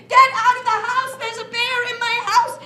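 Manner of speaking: woman yelling at a bear in her house; shouting woman. Acted. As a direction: chase the bear out, loud and high. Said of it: "she was saying".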